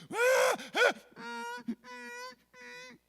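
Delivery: high wail shrinking to muffled whimpers; frightened crying stifled. Retold or imitated. Daughter afraid of dad's voice, imitated stifled crying. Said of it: "by fear of that voice, would go".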